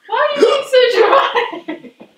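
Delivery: high laughing question. Real, not acted. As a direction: tease him through laughter. Laughing at his reaction.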